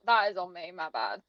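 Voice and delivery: usual speaking voice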